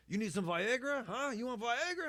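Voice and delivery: high, needling sales pitch; pushy vendor. Retold or imitated. Vendor pushing Viagra on the older buddy, high needling pitch. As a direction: push the pills on him, eyebrows up.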